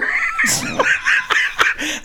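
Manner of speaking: very high burst of laughter; shrieking laugh. Real, not acted. Reacting to the quote.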